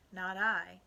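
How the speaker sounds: loud, emphatic refusal; firm declaration. A narrator's reading. Young Tom refusing to help the stranger, declaring it loudly. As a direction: declare it loudly, without raising the pitch. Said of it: "declared young Tom".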